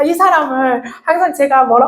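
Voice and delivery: usual speaking voice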